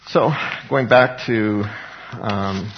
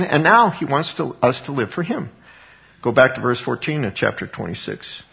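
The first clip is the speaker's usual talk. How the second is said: usual speaking voice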